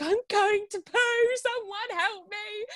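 very high, drawn-out wail for help; mock scream. Woman in labour alone calling for help, high retold scream. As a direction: imitate a desperate scream, stretching each word. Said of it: "I just had to scream out".

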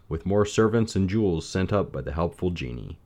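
ordinary delivery

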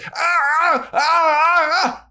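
high, loud hammed-up vampire wail; hammed-up cry of pain; mock pained cry. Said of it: "And he goes".

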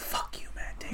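hushed, mock-bitter whisper; whispered curse. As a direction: curse the screen under your breath. Acting out cursing at villain.

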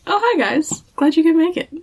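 a breezy, sinister hello; hammed-up cheerful torturer welcoming the brothers; Gordon greeting the boys. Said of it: "And Gordon's like".

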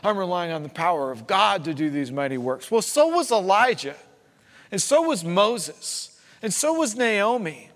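loud, high, building sermon delivery; full-force preaching. Real, not acted. Preacher challenging those who claim God's power, impassioned preaching. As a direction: drive each example home, rising in pitch and force.

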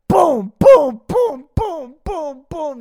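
loud high boom boom noises; bashing sound effects. Acted. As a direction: smash it out loud.

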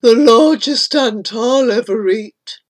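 anteater talking while eating; satisfied creature voice; murmuring creature. A narrator's reading. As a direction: gloat over a meal. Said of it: "murmuring as it chewed".

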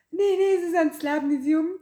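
high mock girly voice; lightly voiced disguise as the princess; high fake princess voice. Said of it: "En ook echt zo".

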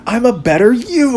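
high, mocking taunt; taunting villain. Batman who laughs taunting Batman, high mocking sneer. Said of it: "he's like".

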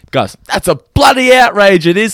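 hammed-up outback indignation; outback Australian. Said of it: "exactly how an Australian in the outback would".

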